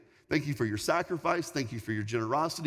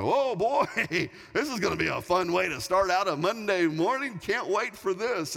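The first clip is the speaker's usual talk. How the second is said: mock enthusiasm; 5 semitones higher than usual. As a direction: groan it brightly with big pitch swoops, sarcastically. Dreading the alerts, sarcastic reaction.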